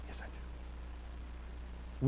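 slow faint whisper. Pretend. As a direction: breathe it out barely audible. Listener's reluctant answer, whispered.

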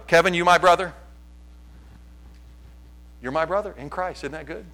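usual speaking voice

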